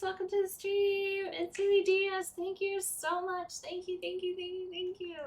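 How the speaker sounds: streamer intro. Acted.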